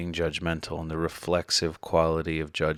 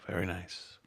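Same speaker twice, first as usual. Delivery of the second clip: guided meditation; 2 semitones higher than usual. Acted. Leads breathing in guided meditation.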